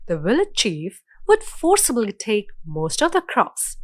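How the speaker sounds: ordinary delivery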